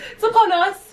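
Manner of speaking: high, loud grand proclamation; dramatic announcement. Acted. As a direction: announce it like big news. Dramatic Valentine's Day announcement.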